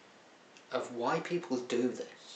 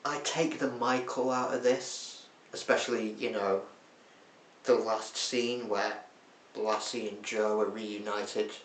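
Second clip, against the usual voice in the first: ordinary talk, nothing marked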